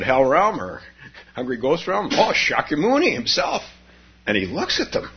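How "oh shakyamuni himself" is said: awed gasp. Acted. Meditators see the Buddha.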